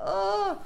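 high mock wail of stress; stressed groan. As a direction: let out an overwhelmed "åh". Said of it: "är så stressad och bara". (stressat, högt stönande)

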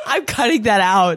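said through laughter, high and loud; laughing. Real, not acted. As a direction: laugh while protesting. Laughing after crude joke.

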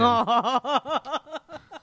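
a short knowing chuckle; knowing laugh. Real, not acted. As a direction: laugh like you know something. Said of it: "That's my knowing laugh".

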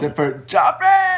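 high squealed correction; excited. Real, not acted. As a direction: blurt it out gleefully. Playful interjection.

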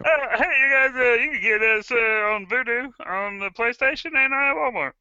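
high twangy hillbilly nerd. Pretend. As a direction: play a goofy hillbilly nerd. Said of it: "like, the hillbilly voice".

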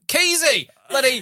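high, excited shout of a name; excited call out. Retold or imitated. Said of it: "somebody's like".

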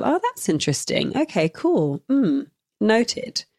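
light, detached musing; note it to yourself without judgement; curious self-talk. Acted. Host acts out the ongoing process of awareness, casually intrigued.

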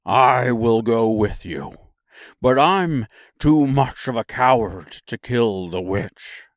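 big beast admitting to cowardice; cowardly Lion. A narrator's reading. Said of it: "said the lion".

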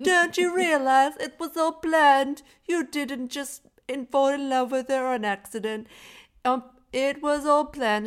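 mocking Wallace. Mocking Wallace's reveal to the hero, slow and high. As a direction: sneer it out like a smug villain. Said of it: "what's he like".

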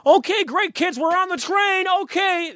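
high-pitched mock American tourist; American accent. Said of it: "this American family ... They're like".

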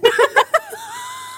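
high shrieking laugh. Real, not acted. Friend cracking up at the gym scene, shrieking laugh. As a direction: burst into helpless shrieks.

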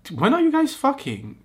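high, loud teasing shout; excited blurt. Real, not acted.

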